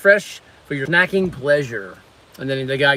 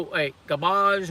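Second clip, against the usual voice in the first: ordinary delivery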